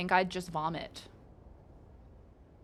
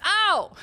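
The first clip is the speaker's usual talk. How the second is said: high, louder mock cry of pain; 11 semitones higher, 7 dB louder than usual. Streamer reacting to damage taken, mock cry of pain. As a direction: play-act getting hit. Enemy attacks her.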